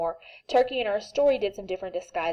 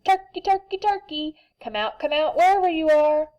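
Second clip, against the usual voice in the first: high, loud sing-song calling; 8 semitones higher, 6 dB louder than usual. Acted. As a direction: call across the barn for the hiding turkey. Farmer calls for hidden turkey.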